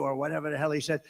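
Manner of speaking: usual speaking voice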